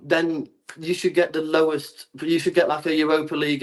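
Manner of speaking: ordinary delivery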